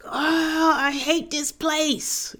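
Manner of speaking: high, drawn-out mock groan; mock exasperated wail. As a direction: wail it in hammed-up frustration. Acting out her own past exasperation.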